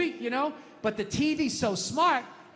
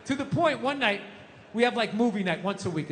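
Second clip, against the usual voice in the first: ordinary talk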